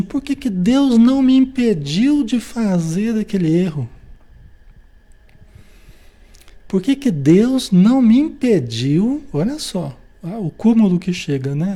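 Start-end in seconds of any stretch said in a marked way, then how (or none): 0.0-9.2 raised, plaintive complaint to God; mock lament. Someone blaming God for their own error, plaintive. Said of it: "Tem gente que fala assim".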